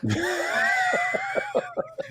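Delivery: high shrieking laugh. Real, not acted. Laughs at teasing jab.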